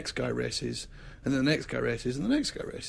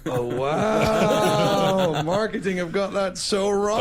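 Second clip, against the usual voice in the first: high, loud, laughing realisation; the penny drops, laughing; laughing surprise; 5 semitones higher, 6 dB louder, much slower than usual. Retold or imitated. Host realising the charity is the racer's wife, loud and laughing. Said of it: "And I went".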